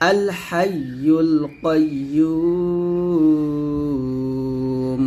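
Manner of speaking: slow recitation with কাইয়্যুম stretched at the end; long final madd. Shown as a demonstration. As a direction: hold the "yuu" of কাইয়্যুম, close on a still m. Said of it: "তিন আলিফ টান দিয়ে মিমটাকে সাকিন". (কাইয়্যুম-এ লম্বা টান দিয়ে মিম সাকিন)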